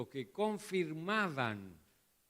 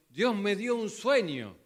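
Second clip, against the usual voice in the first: louder, slow, mocking a dream from God; mock pious claim; 6 semitones higher, 10 dB louder than usual. Preacher mocking believers who claim dreams. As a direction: parody someone proud of a divine dream.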